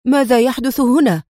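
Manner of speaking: sudden shocked outburst; alarmed exclaim. A narrator's reading. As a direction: cry out in confusion. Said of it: "خالد الذي هتف".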